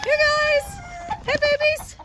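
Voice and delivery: high coaxing call to the hens; calling chickens. Real, not acted.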